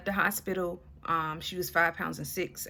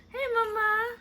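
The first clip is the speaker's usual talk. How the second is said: high cooing greeting; 13 semitones higher, 4 dB louder than usual. Real, not acted. Cooing at the baby.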